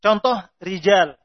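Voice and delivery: ordinary talk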